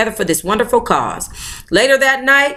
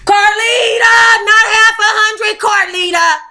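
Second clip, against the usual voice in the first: high, loud teasing call to herself; high, loud playful scolding aside; mock shout; 10 semitones higher, 4 dB louder than usual. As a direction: shout her own name, scolding herself.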